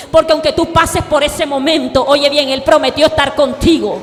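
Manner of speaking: loud shouted preaching, slowing at the end; thundering pulpit exhortation; preaching at full force. Acted. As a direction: drive the promise home to the crowd.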